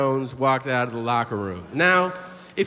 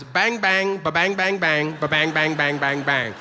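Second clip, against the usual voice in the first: gunfire vibe. Acted. Comic giving a sense of a player's vibe, imitated gunfire. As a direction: rattle it off like gunfire. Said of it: "I'll give you a sense of his vibe".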